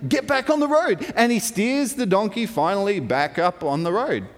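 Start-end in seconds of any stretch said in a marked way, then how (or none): 0.1-1.1 mildly voiced scolding, pitched up; mock scolding of a balky animal. Balaam beating his donkey to get it on the road, scolding.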